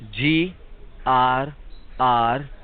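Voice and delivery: slow, letter by letter, pausing; careful dictation. Real, not acted. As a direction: spell each letter out deliberately. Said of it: "Listen to me very carefully".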